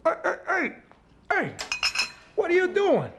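high-pitched "hey!" as the dog grabs food; scolding a pet. Real, not acted.

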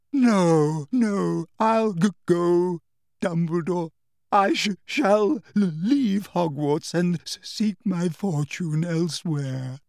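a broken woman fighting tears; tearful stammer. A narrator's reading. Trelawney to Dumbledore, stammering tearfully. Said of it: "still sobbing and choking".

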